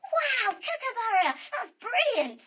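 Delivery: high, wowed exclamation; big high-pitched wow; delighted praise. Acted. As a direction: gush with wonder for little kids. Praises the bird's song.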